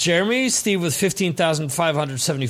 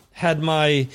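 ordinary talk, nothing marked

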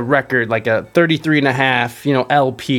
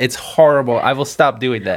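usual speaking voice

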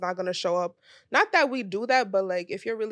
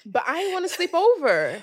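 whiny protest; 8 semitones higher than usual. Real, not acted. Protesting to her friend, high whiny cry. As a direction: wail the objection.

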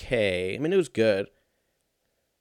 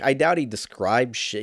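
ordinary delivery

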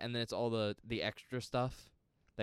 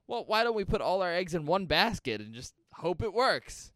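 high, loud, eager mock pitch; pitching an idea; 9 semitones higher, 6 dB louder than usual. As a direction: act out a bold proposal with rising excitement. Voices the studios' new idea.

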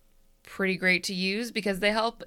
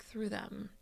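spooky whisper; 10 dB quieter than usual. Acted.

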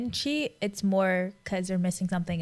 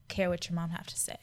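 whispering; 4 semitones lower, 6 dB quieter than usual. Real, not acted. Trailing off into a whisper.

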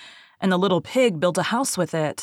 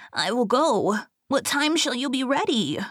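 higher-pitched piglet voice; agreeable little pig; 6 semitones higher than usual. A narrator's reading. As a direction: answer the wolf as a high-voiced pig. Pig accepts wolf's invitation.

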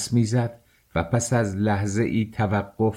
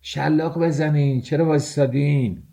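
higher-pitched urging, mildly shouted; crowd shout; 5 semitones higher than usual. A narrator's reading. Crowd egging on the whippers, shouting. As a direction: egg them on with a raised, carrying voice.

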